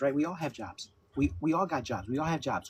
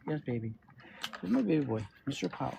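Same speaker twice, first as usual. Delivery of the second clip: high cooing pet talk; 4 semitones higher than usual. Real, not acted. Cooing to his dog Paul on stream, high tender baby talk.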